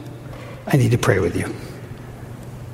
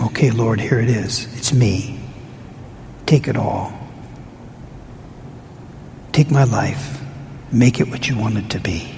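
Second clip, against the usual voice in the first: lower, soft prayer of surrender; 7 semitones lower, 5 dB quieter than usual. Pretend. Pastor modelling surrender to the Lord, lower and soft. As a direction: give it all up, gently.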